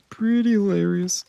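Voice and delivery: playfully high "Pretty hilarious"; high-pitched repeat. Pretend. Streamer repeats his remark for fun, high-pitched.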